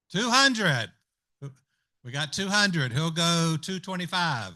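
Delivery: steady auction call, counting up bids; auctioneer calling bids. Acted.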